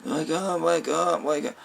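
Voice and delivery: put-on boy trying to wake the lifeless mate; urgent pleading. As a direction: coax a motionless man awake, high and hushed. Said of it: "Tom Holland".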